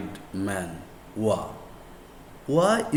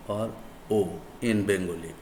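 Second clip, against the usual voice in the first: ordinary talk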